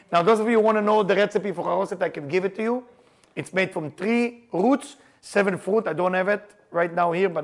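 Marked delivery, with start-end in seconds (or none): none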